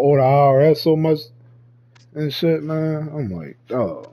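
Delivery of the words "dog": ordinary talk, nothing marked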